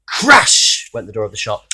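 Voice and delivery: ordinary delivery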